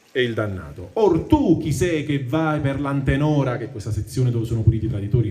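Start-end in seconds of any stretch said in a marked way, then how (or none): 0.9-3.6 slow, measured reading of Dante's verse; poem recitation. Acted. Teacher reading the Inferno to the class, measured recitation. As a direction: recite the tercets with weight. Damned soul's verse read.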